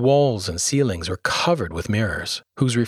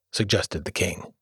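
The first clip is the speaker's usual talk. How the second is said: plain reading voice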